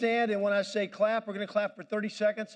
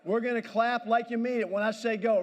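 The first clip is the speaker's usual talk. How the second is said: usual speaking voice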